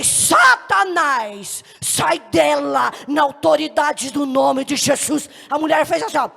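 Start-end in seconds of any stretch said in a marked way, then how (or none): 0.0-5.3 loud, slow, drawn-out shout; authoritative exorcism command. Retold or imitated. Young believer casts out a demon, loud slow commanding shout. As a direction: order the demon out forcefully. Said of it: "Eu saí com uma autoridade".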